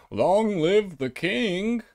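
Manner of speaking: slow, drawn-out mock British cheer; mock British royalist. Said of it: "and you're still saying".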